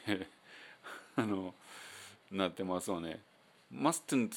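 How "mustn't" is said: "mustn't" with the first T dropped, like "mussn't"; the everyday "mustn't"; silent T mustn't. Shown as a demonstration. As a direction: skip the T in "mustn't". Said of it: "mustn'tと言わずにmustn'tって言うでしょ".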